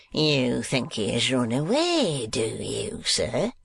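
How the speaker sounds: slow, mocking schoolmaster; slow sneering question; Squeers interrogating. A narrator's reading. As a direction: toy with the boy before punishing.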